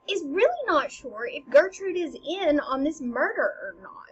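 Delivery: ordinary delivery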